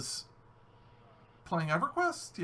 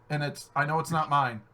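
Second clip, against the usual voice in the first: usual speaking voice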